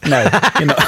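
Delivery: laughs through No, you're not; laughing. Real, not acted. Laughing reversal.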